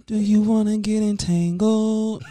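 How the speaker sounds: high, slow, loud Disney princess voice; sing-song it like a cartoon princess; Frozen voice. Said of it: "That's my Frozen voice".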